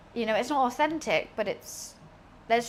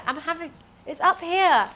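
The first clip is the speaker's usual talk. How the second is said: higher, louder call to the cat; calling the cat, raised; 6 semitones higher, 5 dB louder than usual. Real, not acted. Owner calls cat to the treat, raised call. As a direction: call the pet over to its treat.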